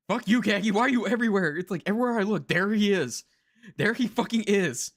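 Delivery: high, loud frustrated ranting; exasperated rant. Real, not acted. Streamer rants at ubiquitous voice actor, loud and exasperated. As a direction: rail at the voice actor who is everywhere.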